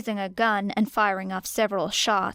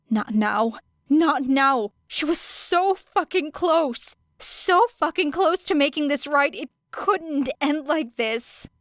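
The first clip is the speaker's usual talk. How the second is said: desperate; 6 semitones higher than usual. A narrator's reading. Era desperate over dying man.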